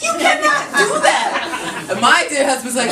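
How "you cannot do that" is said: high, slow, laughing outrage; outraged squeal. Real, not acted. Comic protesting eating a named pet, high and slow. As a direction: stretch out the indignation.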